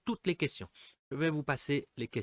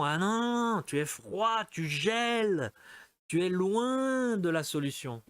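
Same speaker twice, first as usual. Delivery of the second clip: teasing no; 6 semitones higher than usual. Acted. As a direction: stretch out the key words like a playful warning. Acting out the hot-and-cold guessing game.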